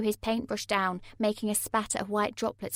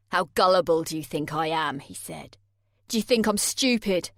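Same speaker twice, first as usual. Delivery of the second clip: angry Jay; 3 semitones lower, 6 dB louder than usual. A narrator's reading. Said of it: "shouting down into my face".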